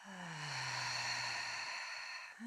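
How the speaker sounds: soft, low, drawn-out exhale; long sigh. Shown as a demonstration. Teacher breathing along with students, long soft sigh. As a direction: breathe out slowly and quietly. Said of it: "And a big sigh".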